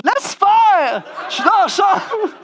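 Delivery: high, loud mock shout; exasperated retort. Traveller answering those who envy his trips, exasperated retort. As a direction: shout down the envious in comic despair.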